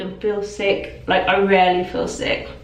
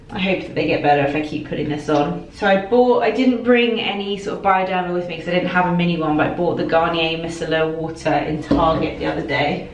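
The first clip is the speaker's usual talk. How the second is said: normal talking voice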